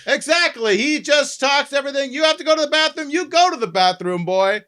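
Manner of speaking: high, shouted orders; incompetent army captain. Acted. Army captain sending a boy to the bathroom, shouting. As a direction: shout everything at full volume. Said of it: "incompetent army captain".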